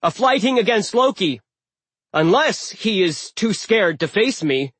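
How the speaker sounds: loud bold challenge; defiant challenge. Acted. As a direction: call him out boldly. Magnus issues challenge.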